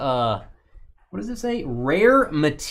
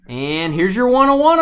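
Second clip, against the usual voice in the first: ordinary talk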